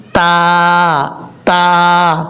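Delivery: تا said thick and full-mouthed, as you should not; teacher showing the wrong heavy تا. Shown as a demonstration. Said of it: "ایسا نہیں کرنا".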